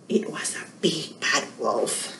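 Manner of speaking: whispered, spooky reveal; hushed whisper. A narrator's reading. Storyteller whispering to kids that the wolf has arrived. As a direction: drop to a whisper to build suspense.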